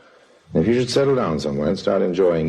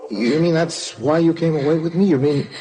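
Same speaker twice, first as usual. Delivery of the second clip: rising, hurt disbelief, higher and higher; stunned betrayal; 9 semitones higher than usual. Acted. Betrayed man confronting his lover, high and incredulous.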